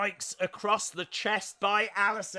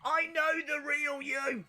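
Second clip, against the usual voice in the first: pleading wrestler; 7 semitones higher, 4 dB louder, slower than usual. Retold or imitated. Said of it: "Marty Bell's like".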